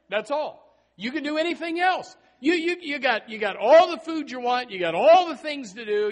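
preacher voicing God's generosity, loud; preaching at full force. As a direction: proclaim God's offer with rising fervor.

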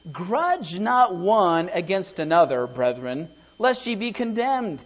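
slow solemn reading of the Bible verse; scripture reading. Acted. Preacher reads James to the church, in a solemn reading voice. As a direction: read the verse like holy writ.

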